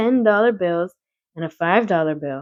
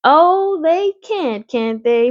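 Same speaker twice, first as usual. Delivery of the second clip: ordinary delivery, nothing marked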